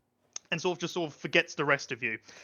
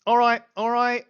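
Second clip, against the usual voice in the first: higher and louder, fed-up concession; exasperated giving in; 7 semitones higher, 8 dB louder than usual. Acted. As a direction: give in with a huff. Lady gives in, exasperated.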